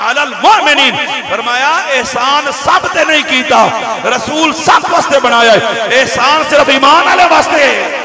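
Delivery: rousing preacher building to a peak; full-force preaching. Real, not acted. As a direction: drive each point home to the crowd.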